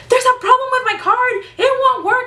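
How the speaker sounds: hammed-up imitation of a customer banging on the glass; shrill mock customer. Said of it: "She was like".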